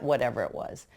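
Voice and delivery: ordinary delivery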